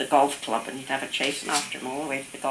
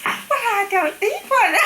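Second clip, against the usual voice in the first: very high, slow, louder protest; mimsy little friend; 6 dB louder, slower than usual. Retold or imitated. Grandmother's friend refusing to have a tooth out, high mimsy protest. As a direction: squeak it out as a mimsy little woman. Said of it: "She would talk like this".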